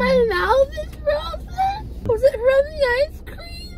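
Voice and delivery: high, fast teary alarm; upset crying. Real, not acted. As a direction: panicky tearful questions. Alarmed groggy child crying.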